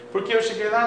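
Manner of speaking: ordinary talk, nothing marked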